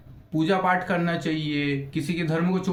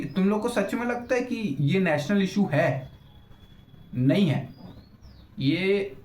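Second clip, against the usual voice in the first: ordinary talk, nothing marked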